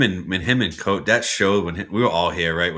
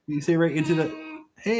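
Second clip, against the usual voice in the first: usual speaking voice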